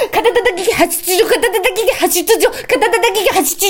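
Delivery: high, amused rattle; rapid tongue twister. Shown as a demonstration. Partner sneaks in a retry, rapid tongue twister. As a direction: rush through all three rounds.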